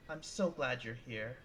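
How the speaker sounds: a hint of a creepy, devoted woman; lightly voiced eerie welcome. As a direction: play her softly pleased to welcome a victim. Game master voices the woman.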